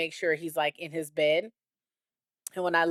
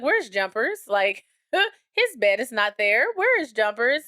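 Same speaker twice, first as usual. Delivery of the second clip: higher-pitched imitation of a child; puzzled child; 4 semitones higher, 5 dB louder than usual. Daughter asking mom where the dog went, imitated puzzled child. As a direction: question it like a bewildered little kid.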